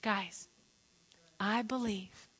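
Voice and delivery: hushed, soft "I believe"; soft, earnest aside; quiet sincerity. Real, not acted. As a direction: bring it right down for the congregation. Turns quietly to congregation.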